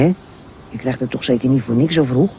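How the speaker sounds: usual speaking voice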